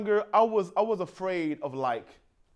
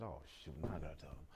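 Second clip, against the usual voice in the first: much softer muttered thought to self; inner voice; 26 dB quieter, much faster than usual. Retold or imitated. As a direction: mutter it under your breath, dreading it. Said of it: "in my head, I said".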